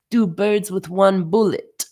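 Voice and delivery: bad Russian accent. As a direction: repeat it in a hammed-up Russian accent. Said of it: "not a very good Russian accent".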